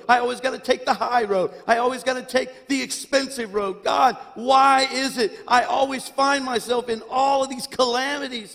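high, loud complaining rant; mock self-pity. Pastor acting out his complaints to God, loud mock self-pity. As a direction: lay the woe-is-me on thick, playfully.

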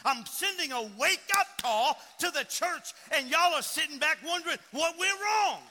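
loud, rising sermon rebuke; fiery preaching. Real, not acted. Preacher rebukes a complaining church, fiery and loud.

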